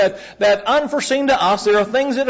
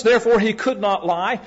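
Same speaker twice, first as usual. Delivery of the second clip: ordinary talk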